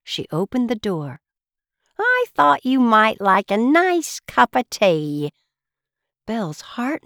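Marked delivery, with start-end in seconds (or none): 2.0-5.3 high, slow, kindly teapot voice; motherly housekeeper. Acted. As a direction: offer it slowly like a doting housekeeper. Teapot housekeeper offers tea.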